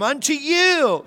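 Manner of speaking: "unto you" slow, high, with "you" hit hard; stress on you. Real, not acted. Stressing the gift is personal.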